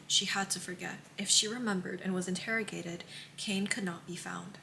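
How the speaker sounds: soft, hushed book reading; quiet story narration; reading aloud. A narrator's reading. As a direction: read the quote almost under your breath. Reads tabbed quote aloud.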